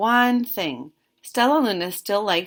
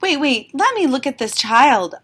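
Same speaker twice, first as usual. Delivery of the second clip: urgent; 6 semitones higher, 6 dB louder than usual. Acted. Mother bat urgent.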